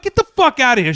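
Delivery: high, exasperated brush-off; indignant dismissal. Retold or imitated. Acted ordering her out.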